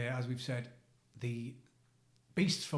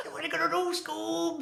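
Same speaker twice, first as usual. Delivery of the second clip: very high cartoon voice; high-pitched goofy cartoon sidekick; Shaggy from Scooby-Doo. Podcaster showing off a party-trick impression, Shaggy voice. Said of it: "So Shaggy from Scooby-Doo is like".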